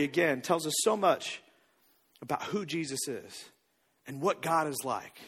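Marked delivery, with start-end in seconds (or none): none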